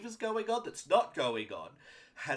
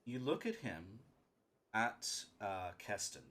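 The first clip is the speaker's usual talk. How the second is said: game master's scene setting; 5 semitones lower, 7 dB quieter than usual. Acted. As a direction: set a somber scene, hushed and measured. Game master describes a character's inner state.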